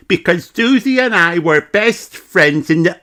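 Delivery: put-on high, bright child voice; chirpy piglet telling her friend; little girl Peppa. Peppa telling Mummy about her best friend, high child voice. Peppa's closing line in story.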